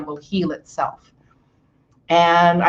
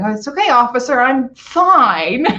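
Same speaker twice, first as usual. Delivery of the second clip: higher-pitched, overwrought reassurance; retold frazzled reassurance; 5 semitones higher, 4 dB louder than usual. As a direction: act out insisting you're okay mid-meltdown. Acts out meltdown before police officer.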